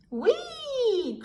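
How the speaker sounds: high gleeful whoop; excited whee. A narrator's reading. Freddy whooping on the sledge, high and gleeful. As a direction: squeal with delight down the slope. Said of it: "cried Freddy".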